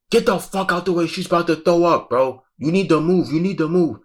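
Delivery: high, urgent yell to clear a path; yelling at the crowd. Retold or imitated. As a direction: shout people out of the way. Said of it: "So I will say anything".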